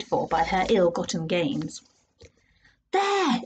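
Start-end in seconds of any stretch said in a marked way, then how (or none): 2.9-3.4 high excited call; exclaiming. Acted. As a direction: point and call out. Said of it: "exclaimed the boy".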